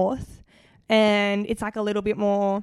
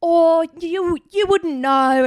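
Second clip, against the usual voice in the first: fake British accent; 6 semitones higher, 4 dB louder, much slower than usual. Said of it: "talking in a British accent".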